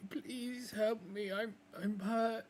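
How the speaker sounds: high, slow, whimpering plea for help; pleading hurt wolf. Acted. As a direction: beg like a wounded young wolf. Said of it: "but he's like".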